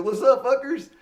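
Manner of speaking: high, loud, cocky hype; loud swaggering shout-out to the crowd; brash crowd greeting. Retold or imitated. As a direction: work the room with a cheeky hello.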